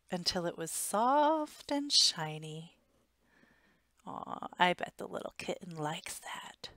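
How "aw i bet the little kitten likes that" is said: whispered aside. Real, not acted. Aside to child listener.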